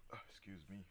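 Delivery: quiet, just burped; talking right after burping. Real, not acted. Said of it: "just burped".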